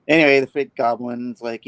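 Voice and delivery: usual speaking voice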